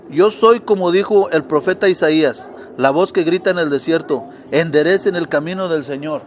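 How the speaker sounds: booming proclamation of scripture; full-force preacher's voice; loud preaching. Acted. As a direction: proclaim it to the whole church.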